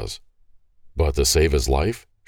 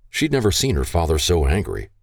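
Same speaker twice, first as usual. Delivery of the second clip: plain reading voice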